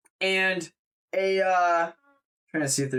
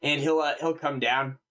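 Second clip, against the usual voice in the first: ordinary talk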